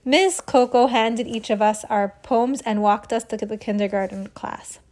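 usual speaking voice

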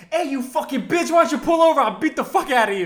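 loud, high-pitched mock threat; aggressive shout. Imitating friend challenging a cop driving by, aggressive shout. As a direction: holler a hammed-up challenge at a passing driver.

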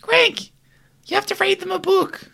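high squeaky mum voice. Retold or imitated. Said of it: "Mum would be like".

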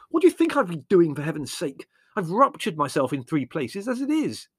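high, indignant protest; exasperated retort. A narrator's reading. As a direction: snap back, fed up and strained. Brothers quarrelling during a rescue.

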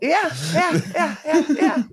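high, giggly repeated yeahs; laughing agreement. Real, not acted. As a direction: laugh your way through agreeing. Amused agreement with co-host's suggestion.

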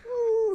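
high sung "ooh"; imitated wheel sound. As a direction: mimic the wheel's ding. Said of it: "it makes a sound".

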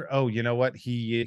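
ordinary talk, nothing marked

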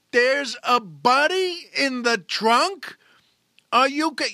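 slow, high, loud disbelief; horrified cop. Acted. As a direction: drag out the shock of a body in the trunk. Cop horrified, body in trunk.

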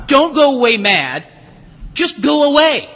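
measured mock-motherly dismissal, pause before the punchline; dismissive mother. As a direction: imitate a fed-up mother shooing the kids. Mother dismissing her noisy children.

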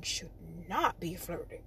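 low, quiet warning repeat; soft, low, pointed aside; hushed emphasis. Real, not acted.

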